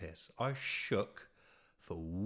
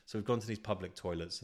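usual speaking voice

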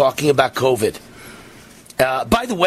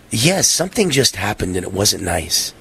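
politician speak; 7 semitones lower than usual. Retold or imitated. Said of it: "politician translate".

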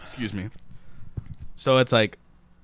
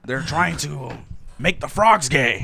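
Alex Jones impression; 4 semitones higher, 4 dB louder than usual. Imitating Alex Jones rant.

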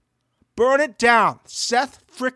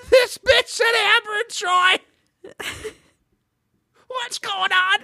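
gleeful shock, voice much higher; incredulous high voice; 12 semitones higher than usual. Real, not acted. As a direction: pitch jumps way up in disbelief. Shocked retelling of the vote.

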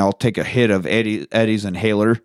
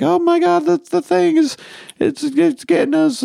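put-on panicked kid voice. Kids warning each other about the light, high panicked squeal. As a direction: shriek it high like a frightened child.

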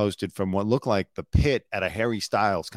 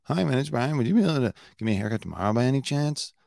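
higher, quick polite request, lifting at "chance?"; imitating upward lilt; 4 semitones higher, much faster than usual. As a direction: mimic a caller, voice rising into "chance?". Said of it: "the way his voice goes up when he's asking for the haircut".

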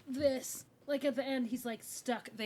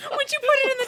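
laughing; 7 dB louder than usual. Real, not acted. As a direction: gloat through laughter. Gleeful laughter, high.

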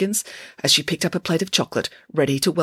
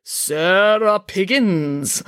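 very slow, raised, resonant proclamation; booming, resonant; 4 semitones higher, 3 dB louder, much slower than usual. A narrator's reading. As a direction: boom the name out grandly, drawn long. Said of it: "boomed Madame Zandra in her beautiful resonant voice".

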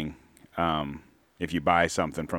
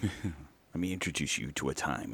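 mock archaic ignorance; 4 semitones lower, 11 dB quieter than usual.

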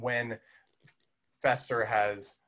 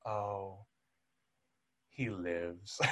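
softer, lower, grieving mother; mock sorrowful sigh; 3 semitones lower, 7 dB quieter, much slower than usual. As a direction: mourn it like bad news. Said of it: "the sorrow in her face".

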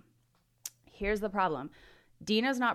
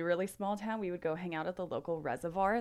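normal talking voice

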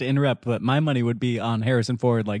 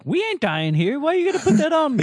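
indignant; 4 dB louder than usual. Retold or imitated. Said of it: "Lando's like".

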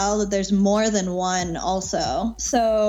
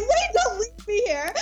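panicked plea; 12 semitones higher than usual. Retold or imitated. Friend begs not to be left.

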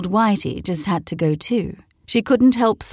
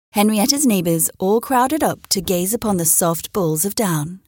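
ordinary delivery, nothing marked

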